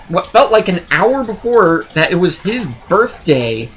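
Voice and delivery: normal talking voice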